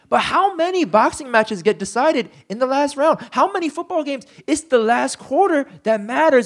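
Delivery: high, loud rising preacher's challenge; preaching at full force. Acted. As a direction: drive the point home to the congregation. Preaching climax.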